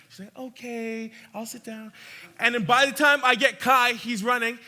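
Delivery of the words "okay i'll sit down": lightly voiced small child, hushed; voicing the little girl softly; toddler reply. Little daughter voiced in story.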